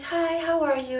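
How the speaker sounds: high, sing-out hello; imitated friendly greeting. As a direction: mimic an oblivious ex-friend's greeting.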